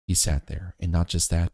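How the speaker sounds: usual speaking voice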